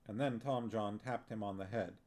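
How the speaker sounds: usual speaking voice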